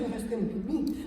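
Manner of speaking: usual speaking voice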